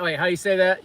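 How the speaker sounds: ordinary delivery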